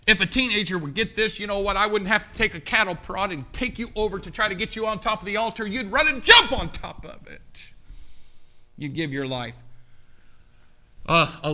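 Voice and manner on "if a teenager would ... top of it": revving up the congregation; building preaching. Real, not acted. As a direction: press the point harder each line. Repeats the point, building.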